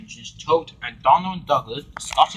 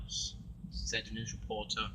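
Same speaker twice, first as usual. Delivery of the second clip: plain reading voice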